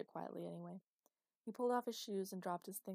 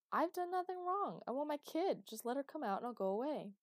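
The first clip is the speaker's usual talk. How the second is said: raised, high-pitched outburst; indignant protest; 7 semitones higher, 7 dB louder than usual. A narrator's reading. Father threatened with security demands his kid, indignant. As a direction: protest loudly and demand her release.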